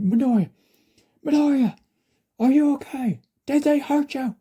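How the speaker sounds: high, loud, anxious cries; worried calling out. A narrator's reading. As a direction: call to a hurt friend in alarm. Worried inmates calling out to him.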